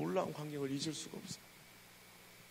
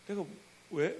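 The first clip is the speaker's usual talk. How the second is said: usual speaking voice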